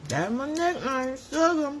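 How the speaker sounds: high-pitched, giggly delight; playful cutesy voice. Pretend. Storyteller claiming the nickname Sugar, playful high voice. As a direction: coo it high and proudly, in play.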